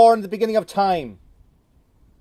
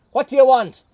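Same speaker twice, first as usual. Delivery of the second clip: loud and high, impatient; mean farmer shouting through the door; gruff shout; 6 semitones higher, 7 dB louder than usual. Acted. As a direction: bark back at the visitor. Atty answers through door.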